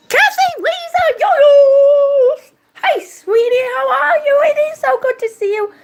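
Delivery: high, loud, delighted welcome; excited greeting shout. Real, not acted. Streamer welcoming Kathy to the stream, excited shout. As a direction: call out joyfully to an arriving friend.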